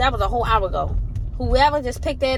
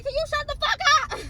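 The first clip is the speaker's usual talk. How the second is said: exasperated retort. Pretend. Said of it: "I want to say".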